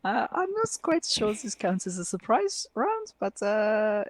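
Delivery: very high, fussy elf voice; high-pitched, dithering elf; theatrical elf, hesitant. Acted. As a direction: hem and haw in a high character voice. Game master rules in elf voice.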